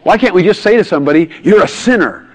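loud, high, exasperated outburst; imitating a fed-up psychologist; frustrated. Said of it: "he was so frustrated, he said".

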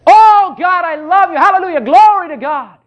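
wholehearted praise. Shown as a demonstration. Preacher shows how to praise in faith, loud and ecstatic. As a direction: throw your whole heart into the praise. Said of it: "Do it in faith".

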